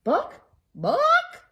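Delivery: high loud clucking book; clucking hen. Acted. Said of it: "clucked the hen".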